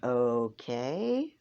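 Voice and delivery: doubtful, questioning okay; puzzled okay. Retold or imitated. Replying to Philza's cryptic answer, puzzled rising okay.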